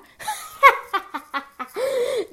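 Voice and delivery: high cackling villain laugh; evil laugh. Acted. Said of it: "That's my evil laugh".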